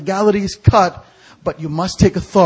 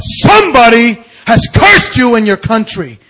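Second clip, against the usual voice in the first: louder, higher proclamation; forceful declaration; 6 semitones higher, 10 dB louder than usual. Retold or imitated. Preacher retells declaring a curse.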